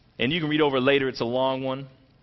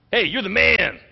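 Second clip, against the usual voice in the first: "Hey, you're the man" said higher and louder like a hyped compliment; casual praise; 7 semitones higher, 7 dB louder than usual. Shown as a demonstration. Preacher showing the wrong reading of Nathan's words, upbeat hype. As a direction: toss off "you're the man" as cheerful slang. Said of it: "He didn't mean it like".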